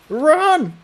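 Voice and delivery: high shouted command. Acted. Incendia shouting at everyone to run, high yell. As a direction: yell at the group to flee. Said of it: "shouted Incendia".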